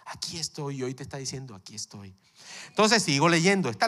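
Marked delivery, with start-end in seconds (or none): 0.0-0.8 breathy whisper of God. Acted. Hijo, hija. 1.5-2.1 very soft. Acted. God's soft voice.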